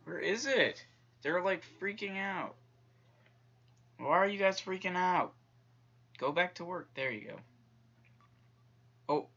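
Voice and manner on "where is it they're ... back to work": raised, higher exasperated voice; loud, high-pitched protest at the crew; exasperated scolding. Real, not acted. Scolding panicking crew in game.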